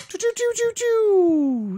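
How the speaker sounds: high mouth-made "choo" sound effect; homemade jingle fanfare. Pretend. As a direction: shoot the choos up high, the last one held loud. Homemade jingle for drink segment.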